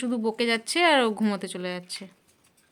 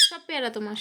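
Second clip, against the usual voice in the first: hushed and gentle, much softer; soft coax to a pet; 3 semitones lower, 9 dB quieter than usual. Real, not acted. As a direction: murmur it to the bird. Coaxing pet to eat guava.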